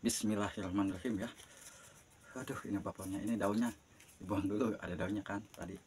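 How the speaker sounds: ordinary talk, nothing marked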